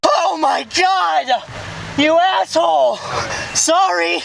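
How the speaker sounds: startled shout. Real, not acted. Scared by the horn at the drive-thru, startled shout. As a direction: jump and yell at the horn blast.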